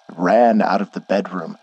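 ordinary delivery, nothing marked

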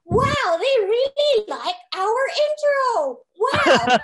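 high, loud, drawn-out awe; amazed reaction. Retold or imitated. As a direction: marvel out loud at the crowd's response. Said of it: "That was my reaction".

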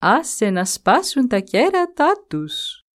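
the great tree calmly answering the buffalo, slow and serene. A narrator's reading. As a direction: speak slowly, completely untroubled. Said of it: "Είπε τότε εκείνο".

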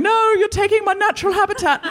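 high, squeaky little elephant complaint; high mock-indignant baby elephant. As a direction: plead like a tiny cartoon elephant. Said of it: "the little elephants down there going".

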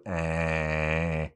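long, held Scouse "Eh"; imitated Scouse filler. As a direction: stretch out a Scouse "Eh" on one level note. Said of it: "more of a pause when you went".

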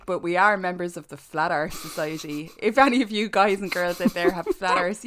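ordinary talk, nothing marked

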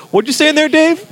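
high-pitched put-on voice, calling over; acted needy questioner. Acting out someone wanting attention.

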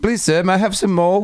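high, put-on British accent; English accent. Shown as a demonstration. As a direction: plead in a movie-style English accent. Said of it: "we'll do one right now".

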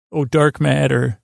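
ordinary delivery

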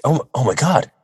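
high, squealing starstruck fan; excited fan. Retold or imitated. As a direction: gush like a thrilled fan meeting idols. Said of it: "and they said".

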